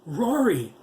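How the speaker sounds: high, loud call of his name; wife shaking her husband awake, loud cry; cried out. A narrator's reading. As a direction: call out sharply to wake him. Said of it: "Judy McAllister cried".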